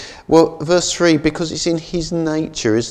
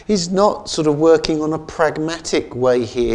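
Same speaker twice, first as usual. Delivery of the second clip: usual speaking voice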